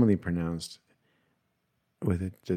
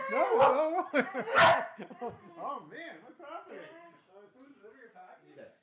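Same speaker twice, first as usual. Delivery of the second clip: high pet voice; 6 dB quieter than usual. Real, not acted. Talking to the barking dog.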